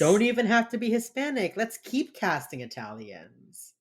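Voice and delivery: mock-enthusiastic suggestion, heavy sarcasm; sarcastic. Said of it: "That's sarcasm".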